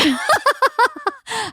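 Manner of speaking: high-pitched burst of laughter; amused high cackle; real laugh. Real, not acted. Laughs at the pay offer.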